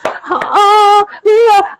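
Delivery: high mock squeal of delight; excited squeal. Imitating the second couple squealing at the table. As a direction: play the couple's giddy joy. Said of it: "second couple is like".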